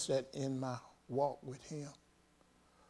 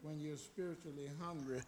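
much softer lead-in to the point; hushed preaching; 13 dB quieter than usual. Real, not acted. Same phrase soft, then repeated forcefully.

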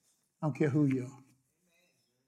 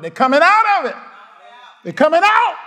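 louder and higher, triumphant; crowd-rousing preacher cry; rising shout; 10 semitones higher, 8 dB louder than usual. Real, not acted. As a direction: lift the repeat into a shout. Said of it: "repeated declaration, built up".

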